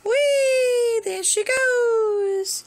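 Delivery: squealing high pet voice, drawn out; high, slow squeal for the kitty; high cooing. Real, not acted. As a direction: coo at the cat like a delighted grandma. Grandma cooing at cat on camera.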